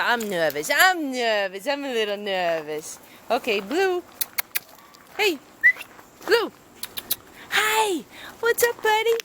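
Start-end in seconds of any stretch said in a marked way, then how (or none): 0.0-3.0 lower, timid dog voice; voicing the dog. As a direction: speak for the nervous pup, pitched low. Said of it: "Oh, she said".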